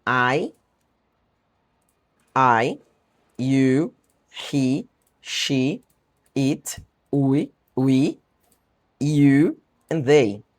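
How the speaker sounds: pronouns read out slowly one by one, "you" drawn out; careful slow drill of the pronouns; pronunciation demo. Shown as a demonstration. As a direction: model the list "I, you, he, she, it, we, you and they". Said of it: "essas aqui são as pronúncias".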